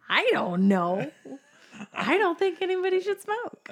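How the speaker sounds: high laughing disclaimer. Real, not acted. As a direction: laugh while saying it.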